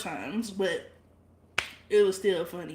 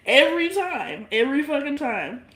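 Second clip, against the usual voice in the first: excited; 5 semitones higher, 5 dB louder than usual. Real, not acted. Laughing about the sketch.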